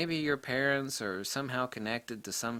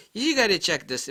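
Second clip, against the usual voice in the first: put-on loud, higher-pitched Boston accent; 8 semitones higher, 7 dB louder than usual. Caller from Boston urging him to look, mock Boston accent. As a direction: imitate an excited Boston caller. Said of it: "with his Boston accent".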